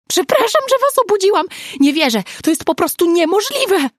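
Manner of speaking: very high, loud, laughing-crying burst; choked-out excitement. A narrator's reading. Nina apologizing to woken friends, overwhelmed and very loud. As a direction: blurt it out between laughter and tears. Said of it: "wykrztusiła".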